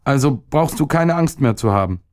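put-on high-pitched grumpy boy; a touch of sulky child; sullen grumbling. A narrator's reading. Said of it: "brummte der Junge verdrießlich". (leicht verdrießlich, hohe Jungenstimme)

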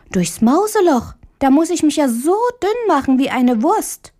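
put-on high, incredulous little-girl voice; high-pitched childlike dismay; little girl Marie. A narrator's reading. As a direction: protest like a small girl who can't believe it.

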